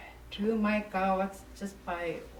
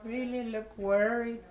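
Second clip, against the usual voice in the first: usual speaking voice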